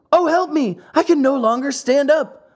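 high, pleading cry; desperate plea. Acted. Lampwick begging Pinocchio as he collapses, desperate. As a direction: beg for help as legs give way. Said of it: "turned to Pinocchio and said".

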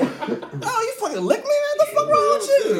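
high squeaky laughing disgust; high-pitched laughing protest; grossed-out outburst. Retold or imitated. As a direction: squeal it in mock horror. Retold disgust at being licked.

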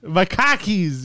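drawn-out Boston "cockies"; Boston accent. Shown as a demonstration. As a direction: stretch "cockies" slowly, Boston style. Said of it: "say words with the accent".

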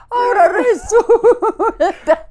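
high, laughing mock outrage, slowed; scoffing at his excuse for men, laughing high. Real, not acted. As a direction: laugh through it in disbelief. Mocking his claim about men.

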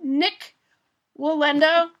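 slow careful words. Pretend. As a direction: balance on each word. Pretend balancing on tightrope.

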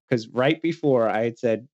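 usual speaking voice

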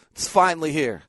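ordinary delivery

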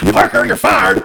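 barking angry boss; J. Jonah Jameson impression. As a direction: yell it like a furious editor. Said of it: "J. Jonah Jameson in the Spider-Man movies".

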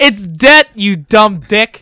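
loud, high exasperated shout, slowing on the insult; exasperated correction. Retold or imitated. Retelling how he finally corrected his friend, exasperated. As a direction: shout the correction, "debt" with a silent b.

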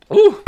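sudden sharp gasp from choking. Real, not acted. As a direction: gasp as water goes down wrong. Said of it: "I just choked on my water".

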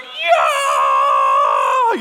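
huge drawn-out mock war cry; amped-up roar. Boys cheering the squashed mouse, imitated amped-up roar. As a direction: imitate boys in a long, loud victory roar. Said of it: "they're so amped".

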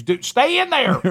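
high-pitched mock scream; screamed warning. Somebody screaming at a man in hiding, mock high yell. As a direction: yell it like someone urgently warning a person in hiding. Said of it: "somebody else screams".

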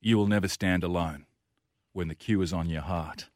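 rousing, measured recital of a tribute poem; poem recitation. Acted. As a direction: recite it like a team battle poem, letting each verse settle.

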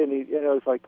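usual speaking voice

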